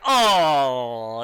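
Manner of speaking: loud, drawn-out crowd "oh"; crowd reaction. Acted. Crowd seeing the fighter go down, long loud reaction. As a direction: groan out loud like a whole crowd. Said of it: "the crowd just like".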